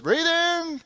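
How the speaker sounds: high sing-out, as if calling back while walking off; comic high-pitched mock sensei; Mr. Miyagi. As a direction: imitate a sensei's high parting call. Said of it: "he's walking off into the night".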